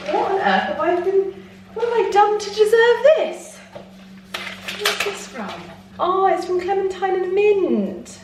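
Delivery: high, bright delight over flowers. Real, not acted. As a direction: gush over a surprise bouquet. Opening flowers, delighted exclamations.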